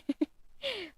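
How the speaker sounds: laugh. Real, not acted. Streamer laughs at anime wish.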